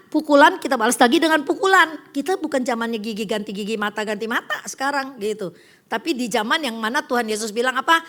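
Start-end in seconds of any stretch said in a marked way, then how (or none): none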